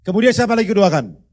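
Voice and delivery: booming question to the congregation; loud pulpit call. Real, not acted. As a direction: call it out across the church. Preacher calling to congregation.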